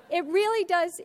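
ordinary delivery

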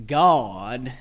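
drawn-out Southern "God"; Southern accent. Shown as a demonstration. Teacher mocking fellow Southerners' dialect, Southern accent. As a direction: stretch it into a Southern drawl. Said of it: "don't want to train your child in a narrow dialect".

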